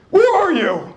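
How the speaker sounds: dad hollering through the house; dad calling out. Retold or imitated. Dad calling for his son, high and loud. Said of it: "I hear my dad say".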